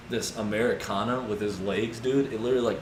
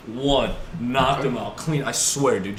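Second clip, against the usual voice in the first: usual speaking voice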